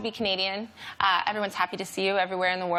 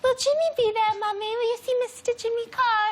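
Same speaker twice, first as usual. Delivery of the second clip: put-on child voice. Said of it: "She goes".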